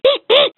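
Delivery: squeaky beeps. Acted. Animal sounds game.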